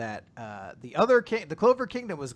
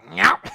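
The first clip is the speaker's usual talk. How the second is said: sudden high, loud nyah; loud "nyah" noise; 5 semitones higher, 15 dB louder than usual. Retold or imitated. Acting out Gelda showing up, loud mock yelp. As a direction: blurt a comic imitated yelp.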